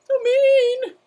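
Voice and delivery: hammed-up shrieked complaint; mock shriek. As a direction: squeal it in playful outrage. Mock outrage at mean terrapin.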